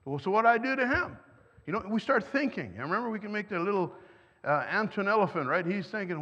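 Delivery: ordinary talk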